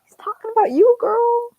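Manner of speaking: high, loud excited rush. Real, not acted. Reader tells her friend the big reveal, excitedly high. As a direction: blurt it out, thrilled and high.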